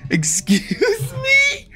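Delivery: high, loud incredulous yelp; half-laughing shocked shout. Real, not acted.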